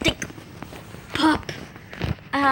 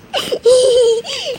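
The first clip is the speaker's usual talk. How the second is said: loud cackle; 12 semitones higher, 10 dB louder than usual. Acted.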